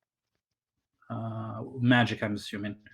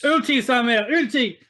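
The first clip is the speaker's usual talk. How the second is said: high, loud shout; excited call-out; 9 semitones higher, 6 dB louder than usual. Pretend. Game master calls for Samir's ultimate, high excited shout. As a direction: yell it out high like an urgent battle cry. Calling out move to player.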